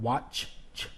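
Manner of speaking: WATCH, then CH alone as a hiss; hissing CH ending. Shown as a demonstration. Teacher pointing out the sibilant at the end, isolated CH sound. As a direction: isolate the voiceless ch after "watch". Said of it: "that hissing sound at the end".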